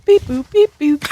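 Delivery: high robotic beep boop; droid beeps. Pretend. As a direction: chirp it like a little droid. Player voicing her droid character.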